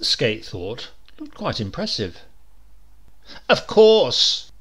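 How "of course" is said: much higher, louder, slow pitch; King pushes the committee, loud and slow; eager ad man. A narrator's reading. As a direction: drive it home like a salesman. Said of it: "King went on".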